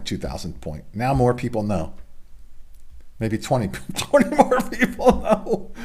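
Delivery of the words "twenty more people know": laughing. Real, not acted. Joking about tiny audience.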